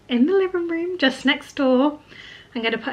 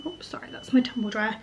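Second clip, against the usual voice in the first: whispering; 8 dB quieter than usual. Real, not acted. Aside about noisy dryer.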